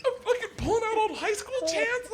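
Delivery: squeaky, barely holding it together; high-pitched laughing. Real, not acted. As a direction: push the words through giggles. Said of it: "his voice gets all high-pitched".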